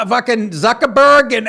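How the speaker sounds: ordinary delivery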